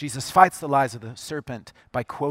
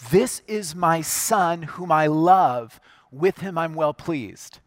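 slow, weighty words of a loving father; voice of God; slower than usual. Retold or imitated. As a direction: let each word land slowly and solemnly. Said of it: "Jesus hears God's voice say".

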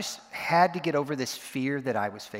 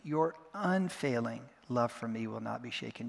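"unfailing" singled out and stretched, rest softer; stress on UNFAILING; 5 dB quieter than usual. Shown as a demonstration. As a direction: lean on "unfailing", then let the line settle. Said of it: "taking it one word at a time".

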